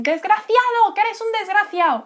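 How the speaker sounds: put-on shrill, high, loud old lady screaming at a thief. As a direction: scream after the purse thief like an outraged old Spanish lady. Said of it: "start screaming at the screen".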